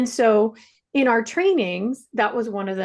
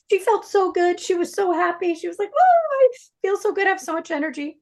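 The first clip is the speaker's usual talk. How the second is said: high-pitched happy gush; elated; 10 semitones higher than usual. Retold or imitated. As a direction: gush with delight, voice lifted high. Retells client's elated reaction.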